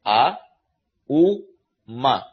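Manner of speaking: "A", "U", "Ma" each said alone with pauses; syllable by syllable. Shown as a demonstration. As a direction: count out the three sounds A, U, Ma separately. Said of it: "You get three syllables".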